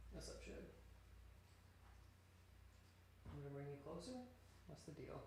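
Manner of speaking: hushed cooing to a pet. Real, not acted. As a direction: gently murmur to the pet. Cooing softly to pet Sugar.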